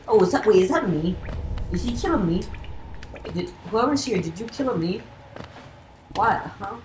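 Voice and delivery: high, startled questions; surprised. Real, not acted. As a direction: caught off guard and alarmed. Sees a copy of himself.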